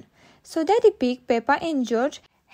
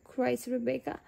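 ordinary delivery, nothing marked

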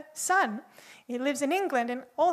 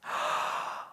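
shocked gasp; 5 dB quieter than usual. Retold or imitated. Shocked at hearing Adventist.

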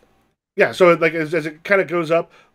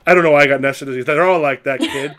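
much louder, fast, laughing as he says it; loud laughing repeat; 10 dB louder than usual. Real, not acted. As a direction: belt it out again while cracking up. GM repeats line loudly, laughing.

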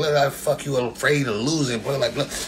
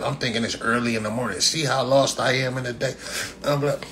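usual speaking voice

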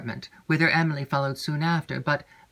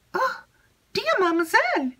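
put-on much higher, eager young maid's voice; chattering maid Annette. Maid Annette eagerly seeking Emily, high excited chatter. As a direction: gush it out high and breathless with news.

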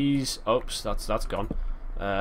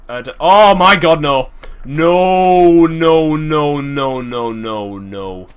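loud panicked no, fading; 3 semitones higher, 10 dB louder, much slower than usual. Real, not acted. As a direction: cry no in panic, then fade. Falling into danger.

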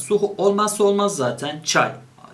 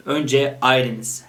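normal talking voice

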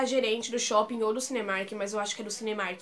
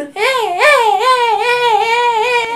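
high, very loud celebrating whoops; jumping cheer; 11 semitones higher, 15 dB louder, much slower than usual. Retold or imitated. Siblings hugging and jumping, re-enacted jumping cheer. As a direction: cheer at full voice, re-enacting the joy. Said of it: "se abraçamos e ficamos pulando".

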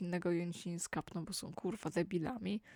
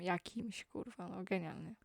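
plain reading voice

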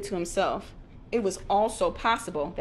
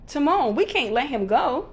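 higher and louder, pressing Timon; urgent plea; 6 semitones higher, 5 dB louder than usual. Acted. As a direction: call out to stop him. Simba urges Timon.

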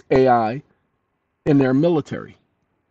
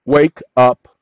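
firm low command; 8 dB louder than usual. Shown as a demonstration. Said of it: "Now, watch this".

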